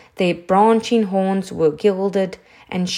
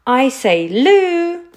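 high, louder call rising on the name; shouting; 7 semitones higher, 4 dB louder, slower than usual. A narrator's reading. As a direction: hail a friend across a distance. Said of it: "So he shouted again".

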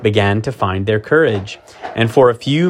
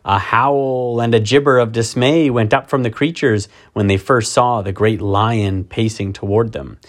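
usual speaking voice

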